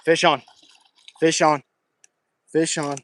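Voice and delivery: loud, triumphant fish on; excited call. Real, not acted. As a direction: shout it out, rod bent. Hooked a fish, calling out.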